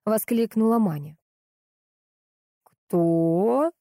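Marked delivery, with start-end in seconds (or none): 2.6-3.7 drawn-out, rising "who?"; ask, completely lost; baffled question, sliding upward; bewildered. A narrator's reading. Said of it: "потерялся Кирилл".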